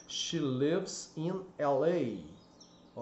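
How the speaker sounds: "she lives in L A" spoken slowly, word by word, short vowel in "lives"; slow model sentence. Shown as a demonstration. Teacher dictating the example for students, very slow. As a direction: spell it out slowly for learners. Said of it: "ela mora".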